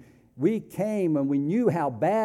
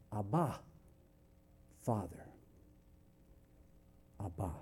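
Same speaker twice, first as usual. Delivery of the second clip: tender cry to father; 4 semitones lower, 13 dB quieter than usual. Retold or imitated. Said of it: "We cry out, emotion".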